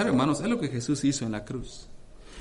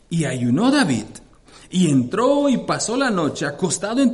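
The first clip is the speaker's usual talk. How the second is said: loud, raised preaching voice, driving each phrase; preaching at full force; 5 semitones higher, 5 dB louder than usual. Acted. Preacher to congregation telling of David, at full force. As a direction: proclaim the scripture to the congregation.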